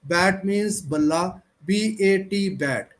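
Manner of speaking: slow drill, pausing between letters; slow with pauses. Shown as a demonstration. Teacher showing students how to read slowly with pauses. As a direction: spell it out with a small stop after each letter. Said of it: "ऐसे करके थोड़ा रुक कर".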